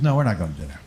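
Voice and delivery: deadpan low impression of the older woman; deep mock woman voice. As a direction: sink way down for her flat no. Woman's flat refusal, acted quote.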